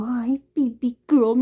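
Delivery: put-on cooing "Hi, baby girl" to a cat; baby talk to a kitty. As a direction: gush over the kitty like a baby.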